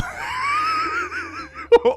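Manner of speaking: high shrieking burst of laughter; a loud, high cackle that breaks out. Real, not acted. Reading the names, she bursts into a loud, high shrieking laugh.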